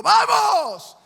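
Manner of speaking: high, loud taunting shout; jeering mockery. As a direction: bellow the taunt as a cruel guard. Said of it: "se burlaban de él diciendo".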